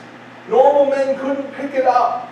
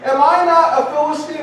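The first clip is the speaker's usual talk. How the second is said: raised-pitch, indignant challenge; boastful warrior calling out; taunting Goliath; 7 semitones higher than usual. Acted. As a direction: talk smack at the enemy ranks. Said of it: "he begins to talk smack".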